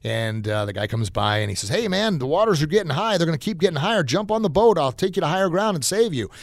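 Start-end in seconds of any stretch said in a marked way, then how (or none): 1.7-6.3 higher-pitched, sing-out hail to someone stranded; acted rescuer's appeal; urgent boatman calling out. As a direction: call over the water, pitched up but not shouting. Boatman calls to stranded man.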